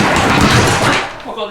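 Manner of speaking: loud breathy oh my God; startled, breathy exclamation; surprised gasp. Real, not acted. Sudden sight of driveway dirt.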